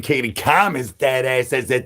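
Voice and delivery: usual speaking voice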